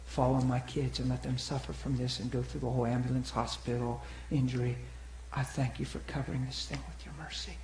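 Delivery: imitating his own prayer; quiet earnest prayer. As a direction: plead with God, hushed and sincere. Retold prayer to God.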